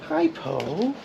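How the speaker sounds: high soft voice for the pet; pet greeting. Real, not acted. Greeting his pet Poe, high and soft. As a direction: coo softly at Poe, pitch way up.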